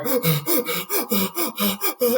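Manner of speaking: high strained gasp for air; desperate gasp. Retold or imitated. Oxygen dropping in hospital, high choking gasp. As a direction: suck in air like you're suffocating.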